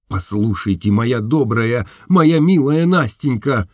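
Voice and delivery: ordinary delivery, nothing marked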